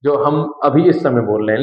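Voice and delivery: ordinary delivery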